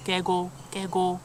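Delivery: rapid, soft 개고 개고; Korean frog croak. Shown as a demonstration. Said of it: "But in Korean".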